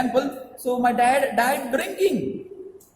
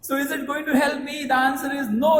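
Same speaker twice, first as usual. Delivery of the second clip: normal talking voice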